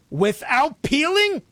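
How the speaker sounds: high, slow, drawn-out shocked gasp of a question; hammed-up disbelief at a fruit eaten with its peel; mock horror. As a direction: act scandalized, stretching every word. Mock horror at eating unpeeled fruit.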